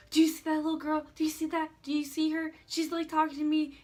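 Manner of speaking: frantic, half-hammed panic about a ghost; freaked out. Coworker freaks out asking if others see the ghost girl, panicky.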